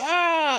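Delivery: high-pitched "aah"; imitated scream of someone being crushed; mock crushed scream. Retelling the elevator crunching down on him, high mock scream.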